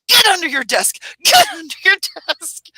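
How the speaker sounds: high, loud mock-panicked yell; urgent shouted order. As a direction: shout it as an emergency command, acted out. Safety person's alarm in earthquake, acted.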